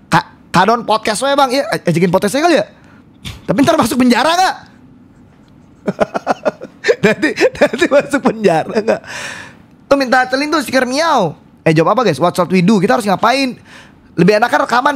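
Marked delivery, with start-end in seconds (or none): 5.8-9.0 slow words broken up by laughing; real laughter. Real, not acted. Streamer joking about ending up in jail, helpless laughter. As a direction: laugh hard, then squeeze the question through it.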